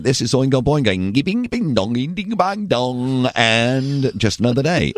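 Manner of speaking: high, sing-song nonsense sounds; zany DJ nonsense. Retold or imitated. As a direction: bounce through the bing bongs like a wacky jock. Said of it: "He'd be, he'd be like".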